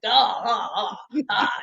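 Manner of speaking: mock mustache-twirling cackle; hammed-up villainous chuckle; evil villain laugh. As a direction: laugh like a cartoon villain, drawn-out ahs. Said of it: "this mustache evil twirling vil".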